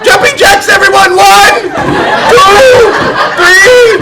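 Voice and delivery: high loud counting out; exercise coach. Acted. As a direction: pump up the class.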